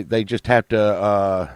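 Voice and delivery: normal talking voice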